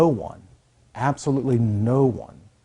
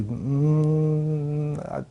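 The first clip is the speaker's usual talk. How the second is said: drawn-out hesitant hum, higher pitch; unsure; 4 semitones higher, much slower than usual. Acted. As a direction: imitate someone stalling, unsure of the answer. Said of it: "Some people say".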